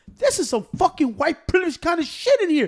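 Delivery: high-pitched indignant protest; imitated outraged accusation. Angry customer accusing the store, imitated high-pitched outrage. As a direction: act out a customer loudly crying foul.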